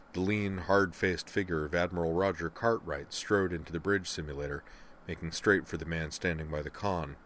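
plain reading voice

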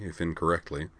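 ordinary delivery

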